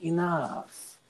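lightly voiced menacing villain; a hint of a cold warlord; unearthly voice. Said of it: "talking like, Ghost of Tsushima's bad guy".

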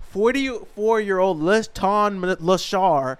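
hammed-up news anchor; mock newscaster. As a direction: deliver it like breaking news, laughing.

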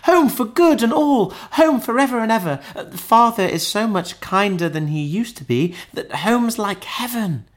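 put-on high, excited child's voice; gleeful little girl. A narrator's reading. Little sister promises home to her brother, brimful of glee. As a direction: gush happily like a small girl. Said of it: "brimful of glee".

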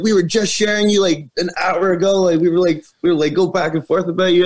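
high, gushing fan voice; excited fan girl. Retold or imitated. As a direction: gush like a starstruck fan.

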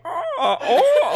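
put-on high laughing old-lady "oh"; drawn-out fond grandma coo; joking "oh" lead-in. Shown as a demonstration. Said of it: "we go like".

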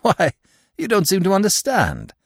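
exclaimed at the stunned inspector; exclaiming. A narrator's reading. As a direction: cry out in disbelief. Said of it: "he exclaimed".

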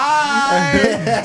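high, loud, laughing goofy goodbye; exaggerated imitation goodbye; mocking bye. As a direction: stretch out a jeering farewell.

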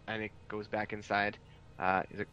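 ordinary delivery

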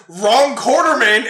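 loud, slow, hammed-up movie quip; exaggerated one-liner delivery; mock action one-liner. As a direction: draw it out like a cheesy action hero. Retold one-liner before shooting.

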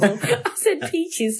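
high, slow giggling line; laughing. Real, not acted. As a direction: giggle the words out. Laughing at own joke.

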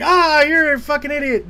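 high, loud mock gloating; jeering villain. Villain jeers at hero, retold.

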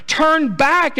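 very high, loud rising command. Acted. Naomi for the third time telling them to turn back, very high loud plea. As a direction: plead harder, third time. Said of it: "She says again for the third time".

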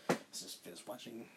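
soft, breathy whisper of a quote; hushed quote. Retold or imitated. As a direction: mutter it under your breath. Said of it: "and I was just like".

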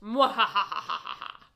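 slow, loud evil laugh; mad scientist cackle. Acted. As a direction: cackle like a gloating villain. Villain gloats after the reveal.

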